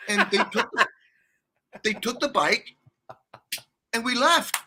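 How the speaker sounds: usual speaking voice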